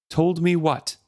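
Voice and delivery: merciless. Acted. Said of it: "he asked her mercilessly".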